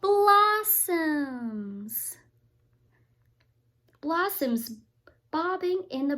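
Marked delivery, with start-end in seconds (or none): 0.0-2.2 loud, drawn-out reveal; big reveal. Acted. Parent revealing the twist to the child, loud. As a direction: announce it as the surprise.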